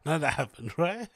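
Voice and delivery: laughing through the words. Real, not acted. Laughing off a denial.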